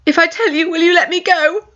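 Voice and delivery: frightened. Acted. Maya in pain, bargaining.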